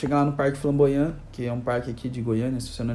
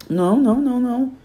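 raised, louder run of refusals; firm objection; 8 semitones higher, 5 dB louder than usual. Acted. Owner defending the tiger, acted indignant objection. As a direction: cut in higher and insistent.

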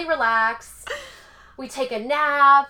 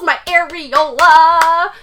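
mortified; 4 semitones higher, 12 dB louder, much slower than usual. Retold or imitated. Mortified retelling.

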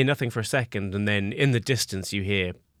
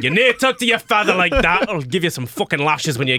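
high, louder indignant rebuke; scolding father; 6 semitones higher, 4 dB louder than usual. Acted. Father telling off his cheeky son, loud indignant scolding. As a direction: scold loudly with pitch jumping up.

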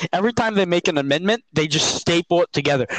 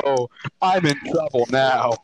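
ordinary talk

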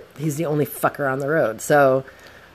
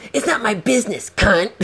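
high-pitched mock snapping; imitated angry retort; 7 semitones higher than usual. Van driver answering scooter rider, imitated high angry retort. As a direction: mimic a driver's indignant insult.

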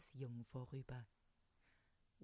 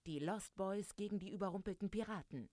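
plain reading voice

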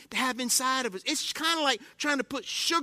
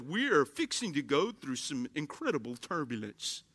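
lower, softer intercom voice of the pilot; airline captain announcement; 8 semitones lower, 7 dB quieter than usual. Retold or imitated. Captain warning passengers over the intercom, lower and softer. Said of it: "And then the captain goes".

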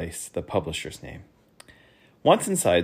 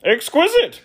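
very high, loud affected voice; prissy courtier; fussy Duke; 8 dB louder than usual. Acted. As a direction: correct him primly. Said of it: "corrected the Duke".

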